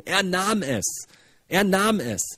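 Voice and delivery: slow, weighty emphasis on NAHM; stress on NAHM. Shown as a demonstration. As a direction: lean long on the word NAHM. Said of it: "da ist eine Betonung drauf".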